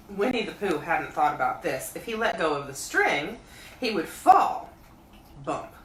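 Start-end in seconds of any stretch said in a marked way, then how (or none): none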